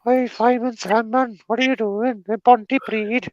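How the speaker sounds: very high sing-song cartoon voice; high-pitched mock Welsh cartoon character; cartoon villager voice. Acting a Fireman Sam cartoon character.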